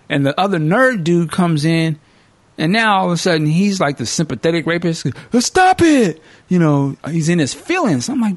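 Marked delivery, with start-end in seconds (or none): 5.3-6.2 high, loud mock protest; mock-whiny accomplice, loud and high. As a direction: yelp it up high. Sympathetic accomplice objects.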